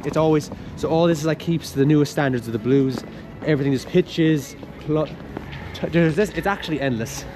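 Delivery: usual speaking voice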